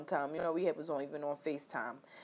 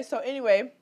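ordinary talk

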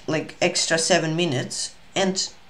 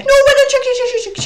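very high, loud squeaky burst; frantic squeal; 8 dB louder, much faster than usual. Real, not acted. Streamer rattling off checks in a chess game, frantic squeal.

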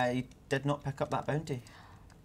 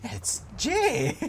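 high, loud mock disgust; imitated disgust; 11 semitones higher, 5 dB louder than usual. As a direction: exaggerate your partner's disgusted correction. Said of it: "The absolute disgust that you just had".

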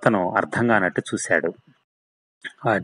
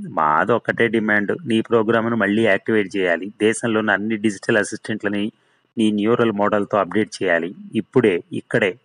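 ordinary delivery

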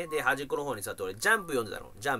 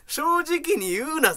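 higher, louder and slowed, a drawn-out protest; exasperated; 12 semitones higher, 5 dB louder, much slower than usual. Real, not acted. The waiting patient complaining about the old man, exasperated. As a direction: scold him in disbelief.

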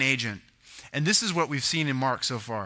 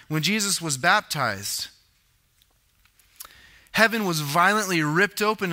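normal talking voice

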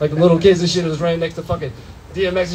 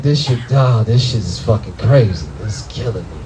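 stoned groan; 10 semitones lower than usual. Retold or imitated. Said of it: "I was like".

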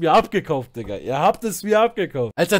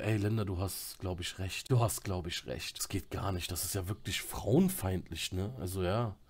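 much lower and much softer, mock-meek apology; fake remorseful role; 11 semitones lower, 15 dB quieter than usual. Streamer fakes meek remorse to Linda, soft and low. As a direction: play the humble, sorry penitent to fool her. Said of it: "hab ich natürlich eine Rolle mir angeeignet".

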